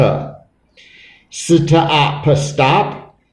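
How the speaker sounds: S, t, o, p, stop, sound by sound. Shown as a demonstration. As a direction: separate the sounds, then blend into stop. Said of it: "so E D sounds like t".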